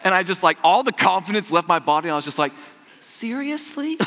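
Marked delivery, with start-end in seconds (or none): 3.0-4.0 disbelief. Retold or imitated. Deflated disbelief.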